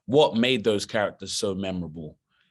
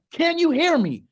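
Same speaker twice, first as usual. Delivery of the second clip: much higher, loud call on a bad line; shouting down the phone; 6 dB louder than usual. Director shouting into the phone to reach the actor in the pool. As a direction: yell it into a crackly phone. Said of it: "He's like".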